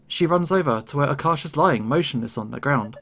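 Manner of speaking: ordinary delivery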